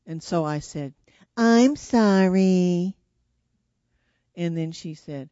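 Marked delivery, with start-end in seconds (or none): 1.4-3.0 loud, slow, grudging fake sorry; insincere apology. Child forced to apologize to her sister, drawn-out and insincere. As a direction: drag out a sorry you don't mean. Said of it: "I just pretended to".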